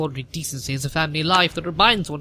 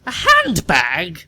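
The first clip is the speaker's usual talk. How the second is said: loud, slow, stretched-out incredulous question; appalled disbelief; 7 dB louder, much slower than usual. Acted. Lady Bracknell hearing he was found in a handbag, slow appalled disbelief. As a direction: drag the word out in scandalised horror.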